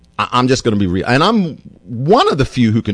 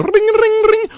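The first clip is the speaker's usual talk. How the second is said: much higher, sing-song ring ring; mock phone ring. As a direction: imitate a ringing phone up high. Imitating a phone ringing.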